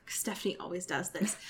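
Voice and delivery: unvoiced conspiratorial whisper; whispered aside. Real, not acted. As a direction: whisper it as a secret.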